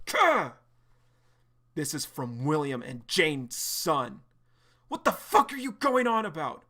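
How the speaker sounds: John losing it in a fight, raging shout; furious shout. Acted. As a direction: scream it at the man who wronged you. Voicing John's violent outburst.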